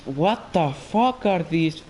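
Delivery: normal talking voice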